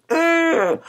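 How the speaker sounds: high squeezed uh; the cramped vowel to avoid; wrong closed uh. Shown as a demonstration. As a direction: pinch the vowel shut and high. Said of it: "You don't wanna sort of go".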